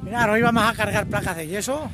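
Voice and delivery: usual speaking voice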